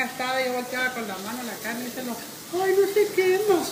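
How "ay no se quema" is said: loud, high mocking quote. Mocking the cook who doesn't feel the heat, loud and high.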